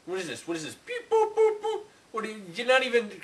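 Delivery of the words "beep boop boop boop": high imitated electronic beeping; mocking machine noises; mock robot beeps. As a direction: parody a robot with high beep-boops. Man mocks the robot's beeping.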